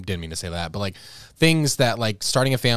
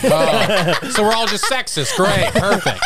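sarcastic, laughing through it; mock dismay while laughing; laughing sarcasm; 7 semitones higher, slower than usual. Mock reaction to riddle answer.

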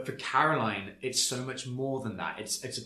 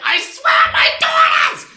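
much higher, much louder, shouted pleading; mock desperate shout; 18 dB louder than usual. Mimicking Teresa pleading with her accuser in a hammed-up shout. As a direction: yell it like a hammed-up frantic housewife.

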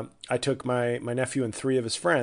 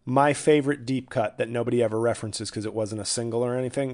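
normal talking voice

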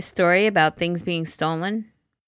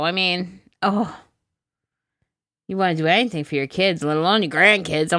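ordinary talk, nothing marked

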